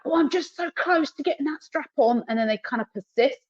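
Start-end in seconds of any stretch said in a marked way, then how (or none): 0.0-2.2 impatient owner. Retold or imitated. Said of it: "they're like".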